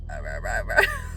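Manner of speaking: loud mock monster babble; wordless croaky "rah-rah" rising at the end; monster-like lost voice. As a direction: imitate a failing voice as monster noise. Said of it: "I'm talking like a, a monster".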